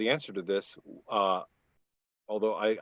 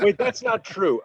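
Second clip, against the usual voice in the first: higher-pitched, through laughter; laughing protest; 9 semitones higher, 5 dB louder than usual. Real, not acted. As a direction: object while laughing. Laughing protest to co-panelist's teasing.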